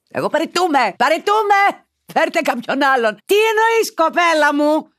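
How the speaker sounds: high, loud mock resignation; hammed-up incredulous yelling; outraged outburst. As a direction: shriek it in theatrical disbelief. Mock resignation at the shocking letter.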